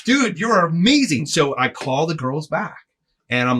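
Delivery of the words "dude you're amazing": much higher, giddy with delight; thrilled disbelief. Retold or imitated. Greg gushing about the helpful Zamboni guy, high and thrilled. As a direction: gush like you can't believe your luck. Praising the helper.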